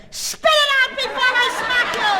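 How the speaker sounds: very high, loud comic shriek; furious mom scream. Acted. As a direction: explode at the child at full volume. Mother screams at child chewing butts.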